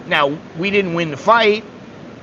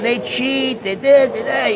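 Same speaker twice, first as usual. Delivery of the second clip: much higher, insistent mock rant; imitating an outraged fighter; 9 semitones higher than usual. Fighter protesting the decision in the ring, hammed-up outrage. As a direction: rant like a boxer who swears he was cheated. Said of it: "He's going off".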